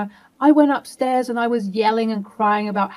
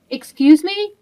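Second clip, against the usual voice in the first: much higher and louder, outraged; indignant excuse me; 9 semitones higher, 7 dB louder than usual. Acted. Wife answering husband who called her dramatic, indignant. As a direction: bristle at the insult.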